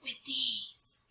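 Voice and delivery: slow breathy whisper; whispered secret. Acted. Girl tells the trolls a secret, slow whisper. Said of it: "I whispered".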